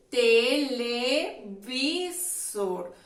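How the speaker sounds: Televisor stretched out very slowly, syllable by syllable. Shown as a demonstration. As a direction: spell Televisor out slowly for children. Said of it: "vamos a decir su nombre".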